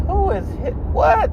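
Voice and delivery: jumps way up high in sudden alarm; a squeaky startled who-what. Retold or imitated. Comedian hiding in the bedroom reacting to a crash, high startled squeak.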